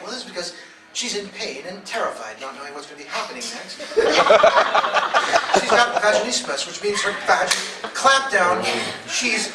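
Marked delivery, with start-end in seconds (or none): 3.8-5.5 loud breathy laugh. Real, not acted. Comic cracking up at his own line. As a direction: burst out laughing.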